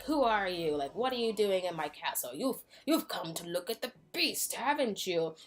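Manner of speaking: mock angry beast. Beast grabbing Maurice in his castle, mock angry demand. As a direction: confront the intruder in mock outrage.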